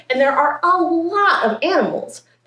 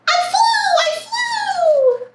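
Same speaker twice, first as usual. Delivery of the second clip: very high, drawn-out squeal of joy; thrilled high-pitched cry; elated little bird; slower than usual. Acted. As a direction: squeal it in a tiny, overjoyed bird voice. Bird celebrates first flight.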